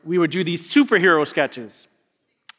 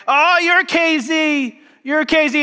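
put-on excited kids; 9 semitones higher, 3 dB louder, slower than usual. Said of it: "the kids were like".

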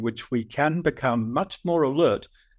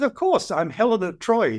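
proud, matter-of-fact claim voiced higher; Helen of Troy; 8 semitones higher than usual. Feisty older lady on the plane claims to be Helen, proudly. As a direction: announce it as if it were obvious. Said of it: "She says".

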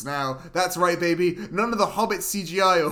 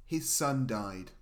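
mock solemn; 7 semitones lower, 12 dB quieter than usual. Reviewer revealing the son's death, mock solemn. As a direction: drop low and let each phrase sink in.

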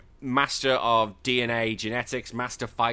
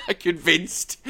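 mocking laugh; 5 semitones higher, 3 dB louder, slower than usual. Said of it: "That's how she says it".

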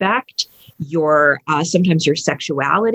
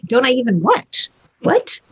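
baffled question leaping high at the end; incredulous; 5 semitones higher than usual. Retold or imitated. Parent thrown by the son's warning, incredulous. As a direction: act out disbelief, pitch jumping up.

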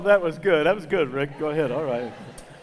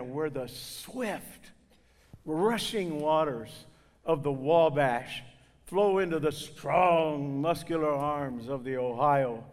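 slow poetic recital; 2 semitones lower, slower than usual. Acted. Poetic description of his home.

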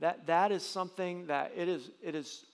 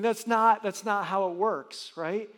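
suddenly much louder, pushing the word not; emphatic outburst; 4 semitones higher, 9 dB louder, slower than usual. Acted. Pastor to congregation rejecting rotating preachers, loud and emphatic. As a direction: burst out to shut the idea down.

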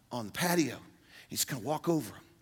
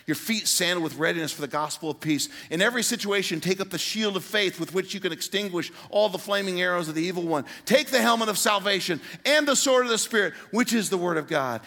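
scripture rising higher and louder to a peak; preaching build; 4 semitones higher, 5 dB louder than usual. Acted. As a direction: climb toward a ringing preacher's call. Reads armor verses building up.

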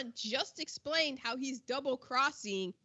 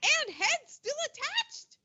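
incredulous; 12 semitones higher than usual. Real, not acted. Incredulous retelling of body dump.

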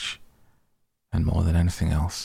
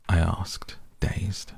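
ordinary delivery

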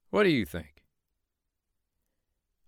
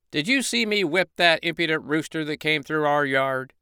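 high, boastful rooster voice; bragging rooster; 6 semitones higher than usual. Acted. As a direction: crow about a win you never had.

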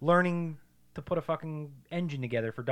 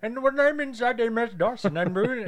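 mock character voice; 8 semitones higher than usual. Imitating a character.

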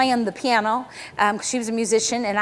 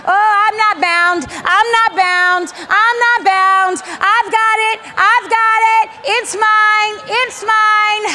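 high, loud, repeated declarations at full force; triumphant shout; 10 semitones higher, 7 dB louder, slower than usual. Real, not acted. Preacher declaring freedom for the congregation, triumphant shout. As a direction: shout each claim like breaking free.

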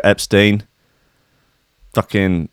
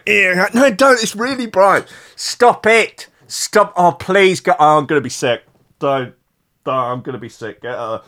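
whiny high pleading that sinks to a queasy mumble; hungover pleading; 6 semitones higher than usual. Retold or imitated. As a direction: beg them to stop, then fade toward being sick. Acting out hungover plea at friends.

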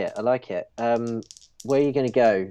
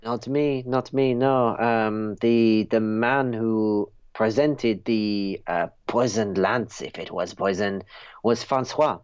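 put-on French accent. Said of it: "your French accent".

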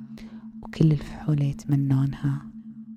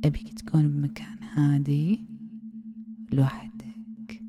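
ASMR whisper. Acted. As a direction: lull him in with a hushed hypnotic whisper.

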